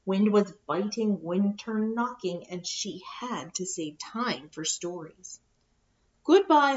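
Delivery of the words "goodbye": lifted, eager goodbye; bright goodbye. Acted. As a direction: call out goodbye cheerfully. Bear bidding her hole goodbye.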